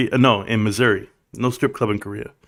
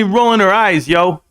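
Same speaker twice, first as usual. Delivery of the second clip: normal talking voice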